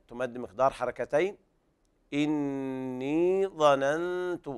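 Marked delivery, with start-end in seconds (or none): 2.1-4.5 إني with a long doubled nasal n, very slow; nasal hiding. Shown as a demonstration. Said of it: "وهنا إخفاء حقيقي". (غنة وإخفاء في إني ظننت)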